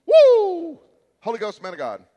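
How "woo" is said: high, loud whoop; mock ecstatic whoop. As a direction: whoop like instant revival joy. Mock altar-call celebration.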